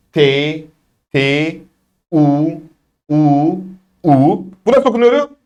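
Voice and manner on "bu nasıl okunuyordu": high-pitched quiz question; pitched-up teacher prompt; quizzing. Shown as a demonstration. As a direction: quiz the class in a raised voice.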